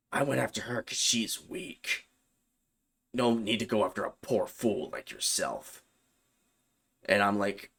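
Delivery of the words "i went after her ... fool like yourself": soft, eerie possessed voice; a cold voice that isn't hers; demon voice. Retold or imitated. Demon in Bailey refusing to give its name, in a soft eerie voice.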